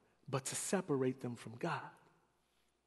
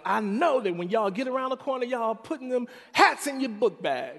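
higher, louder mother announcing a vision; mom's voice; 9 semitones higher, 8 dB louder than usual. Retold or imitated. Sermon retelling: mother telling her boys, raised louder voice. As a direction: play Mama proclaiming the Lord spoke.

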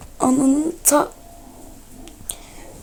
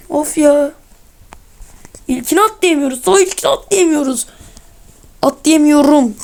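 exasperated complaint; 3 semitones higher than usual. Real, not acted. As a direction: protest the failed jump with rising frustration. Cannot make the first jump.

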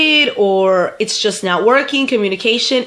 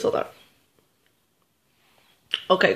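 usual speaking voice

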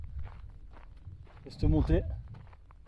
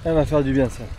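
excited; 5 dB louder than usual. Real, not acted. Thirsty hiker finds fresh water.

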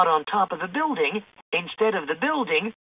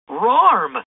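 loud, higher dog yelp; excited dog bark-word; 4 semitones higher, 7 dB louder than usual. A narrator's reading. Scooby jumping up and down, excited dog yelp. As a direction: bounce it out like an eager pup. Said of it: "Scooby said, jumping up and down".

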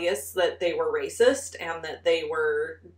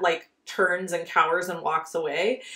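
ordinary delivery

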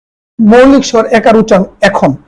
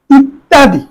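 usual speaking voice

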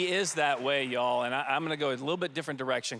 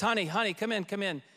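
higher, eager calling out to his wife; a mock presidential "honey, come in"; affectionate call; 6 semitones higher than usual. Press aide retelling the president calling his wife in, lightly imitated.

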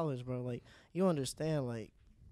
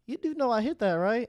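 imitated DM; 9 semitones higher, 8 dB louder than usual.

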